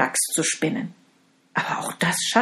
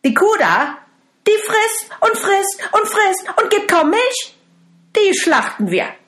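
much higher, louder, scornful wicked stepmother; spiteful stepmother; 6 dB louder than usual. Acted. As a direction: demand the cow be slaughtered with contempt.

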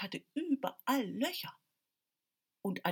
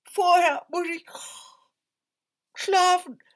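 tired little tailor; 10 semitones higher, 11 dB louder than usual. Acted.

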